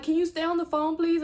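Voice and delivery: high, loud frightened begging; panicked plea. Retold or imitated. Scared girlfriend begging his mom to stay on the phone: high, loud pleading. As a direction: beg her to stay on the line, scared.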